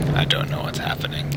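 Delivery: hushed, bewildered whisper. Real, not acted. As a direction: breathe it out in disbelief. Repeats in a whisper.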